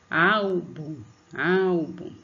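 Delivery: "Álbum" said loud, as if calling someone, stressing "Ál". Shown as a demonstration. Teacher demonstrates calling out "Álbum" with stress on "Ál". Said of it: "como se estivesse chamando alguém".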